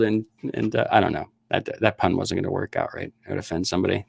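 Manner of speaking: usual speaking voice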